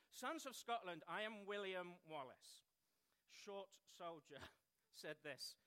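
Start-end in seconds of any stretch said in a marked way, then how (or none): 0.1-2.7 put-on battle speech in own English accent, louder; William Wallace. Speaker replaying a film hero's speech to his army, loud declaiming in English accent. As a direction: address the troops, raised voice, no Scottish accent. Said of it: "I'm just gonna speak in English".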